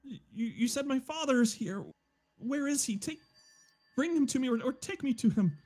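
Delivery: very high, stammering pleading voice; Nefertak. Acted. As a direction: plead urgently in a high-pitched character voice. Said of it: "You hear Nefertak reach out into your head".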